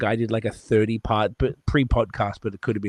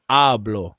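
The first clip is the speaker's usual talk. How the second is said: hablo slowed, first syllable HA drawn out and punched; stress on the first syllable; 5 dB louder than usual. Shown as a demonstration. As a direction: hablo as HAA-blo, the first syllable leaned on and stretched. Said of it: "the second last syllable is always".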